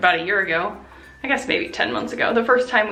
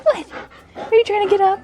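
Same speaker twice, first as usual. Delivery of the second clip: much higher, louder pet voice; 13 semitones higher, 6 dB louder than usual. Real, not acted. Owner cooing at her dog trying to get up, in baby talk. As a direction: coo at the dog as he stirs.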